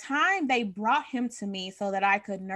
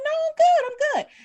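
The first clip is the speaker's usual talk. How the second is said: much higher and louder, brushing it off; fake fine; 8 dB louder than usual. Red-eyed mother brushing off the doctor, forced cheer. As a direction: brightly deny anything's wrong. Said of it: "I'm like".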